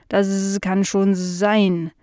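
slow housefly with drawn-out buzzing s sounds; curious housefly. Acted. Fly meeting Nala for the first time, slow and buzzy. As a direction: stretch the words like a dopey, curious fly.

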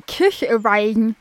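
slow mumble round a stick; mumbling with mouth full. Acted. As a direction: talk with a branch between teeth. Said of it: "nuschelte sie mit dem Ast im Mund".

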